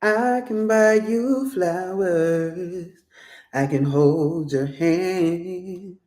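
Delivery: slow, lightly crooned promise; a hint of a smitten suitor, slowly; slow lover's voice. Reader voicing the lover to the viewer, slow and crooning.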